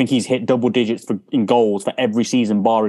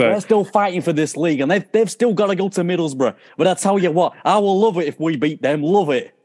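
high-pitched imitation of a fired-up manager; mimicked post-match outburst, pitched up; Kevin Keegan rant; 7 semitones higher than usual. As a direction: put on the accent and rant defiantly. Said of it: "when Kevin Keegan was like".

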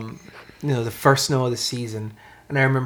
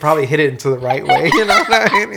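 talking through laughter, louder and higher; amused, pitched-up, loud chuckling delivery; laughing; 4 semitones higher, 9 dB louder than usual. Real, not acted. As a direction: laugh your way through the joke. Joking about his broken nose.

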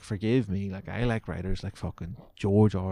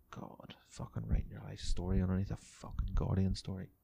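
scornful whisper; 7 dB quieter than usual. Real, not acted. Scornful aside about a comment.

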